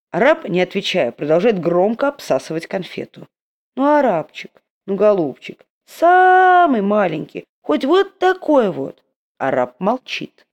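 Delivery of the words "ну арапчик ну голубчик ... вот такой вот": high, slow whining plea; wheedling beggar. Acted. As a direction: beg sweetly, dragging it out. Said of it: "униженный нищенский голос".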